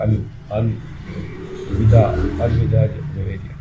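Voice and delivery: slow, halting, pained goodbye; pained farewell. Acted. Dying husband bids his wife farewell, slow and pained. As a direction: drag out الوداع slowly as if dying. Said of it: "Noman تکلیف دہ انداز میں".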